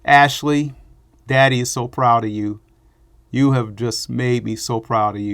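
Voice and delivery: normal talking voice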